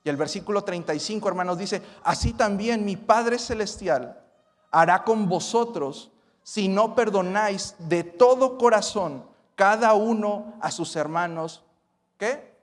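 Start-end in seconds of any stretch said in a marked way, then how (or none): 2.0-11.6 loud, high proclamation; fervent preaching. Acted. Preacher proclaiming the verse to his brothers, fervently. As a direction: drive the warning home.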